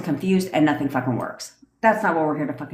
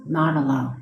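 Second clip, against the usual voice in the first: guided meditation. Acted. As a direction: guide them into calm visualization.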